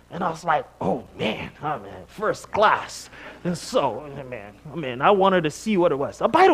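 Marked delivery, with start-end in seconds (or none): none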